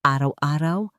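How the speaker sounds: usual speaking voice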